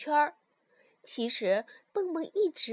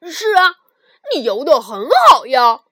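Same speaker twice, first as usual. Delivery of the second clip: loud; 17 dB louder than usual. Acted. Quarrel answer.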